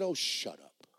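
low, soft irritated brush-off; annoyed dismissal. Retold or imitated. Maintenance man to screaming neighbour, quietly annoyed. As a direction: mutter it, fed up.

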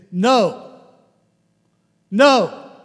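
loud, firm "No. No."; firm refusal. Retold or imitated. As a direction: shut the idea down, loud and sure. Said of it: "And, and she goes".

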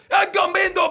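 high, loud hammed-up wrestler yell; mock Mexican wrestler shout. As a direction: bellow the ring name like a fake luchador. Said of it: "he played a Mexican".

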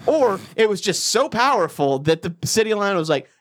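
ordinary talk, nothing marked

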